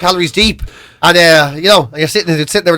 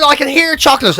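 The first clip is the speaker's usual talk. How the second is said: ordinary talk, nothing marked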